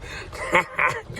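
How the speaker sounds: a high, airless chuckle; wheezy high laugh. Real, not acted. Vlogger laughing at his God joke, wheezy high laugh. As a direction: crack up at his own joke.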